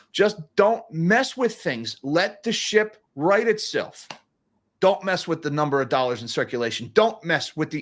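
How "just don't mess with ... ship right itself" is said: drawn out, a bit higher, pleading; slow it right down and plead; slow emphatic plea. Real, not acted. Begging the Fed to leave things alone, slow and pleading. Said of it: "pleading for the Fed to do nothing".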